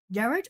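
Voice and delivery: "Garage" as GARR-ij, rising; trying a pronunciation. Shown as a demonstration. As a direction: say "Garage" with first-syllable stress and -ij ending. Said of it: "The pronunciation of gar".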